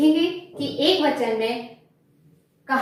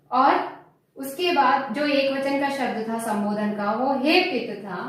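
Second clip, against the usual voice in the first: usual speaking voice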